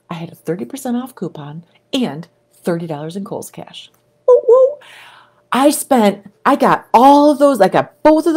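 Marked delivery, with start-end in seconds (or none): 0.1-3.9 whispered like a secret. Real, not acted. Letting viewers in on a shopping secret, whispering. Secret-sharing aside about coupons. 4.3-4.8 high cheering whoops. Real, not acted. Celebrating the bargain to viewers, high cheering whoops.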